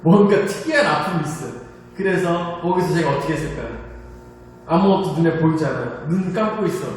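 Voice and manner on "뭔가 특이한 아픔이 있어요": ordinary talk, nothing marked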